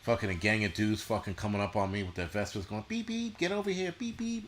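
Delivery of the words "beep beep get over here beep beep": high squeaky voice beeping like a scooter horn; mock Vespa hipster. Hipsters on Vespas beeping at him, high squeaky mock voice. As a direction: squeak out the beeps like a silly hipster.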